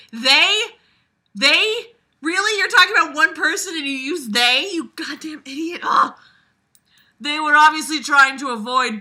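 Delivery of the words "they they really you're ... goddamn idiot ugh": high, loud, scornful jeer at the writer; mock outrage. Reader mocking the author's use of they, high and loud jeer. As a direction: squeal it out in disbelief, mocking.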